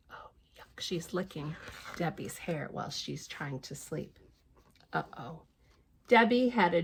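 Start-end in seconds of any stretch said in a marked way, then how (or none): 0.1-5.4 whispered disgusted aside; soft unvoiced commentary. Real, not acted. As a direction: hush it so Debbie stays asleep. Whispered aside about Mog.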